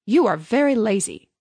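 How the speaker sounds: reproachful miller's voice; scolding miller. A narrator's reading. Miller finds Hans still in bed, mildly scolding. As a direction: lightly scold a lazybones.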